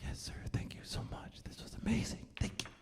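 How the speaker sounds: hushed, breathy gratitude; acted soft, grateful whisper; awed whispered thanks. Acting his awed, grateful reply.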